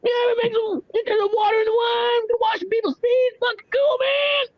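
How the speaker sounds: very high, shouted party-guy hollering; shrill-pitched rowdy dude impression; party-dude Jesus. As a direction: holler to your buddies at the top of your range. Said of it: "probably was great. It's like".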